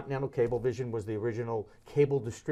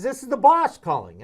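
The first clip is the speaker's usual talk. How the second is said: imitated Steinbrenner; 9 semitones higher, 10 dB louder than usual. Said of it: "he says".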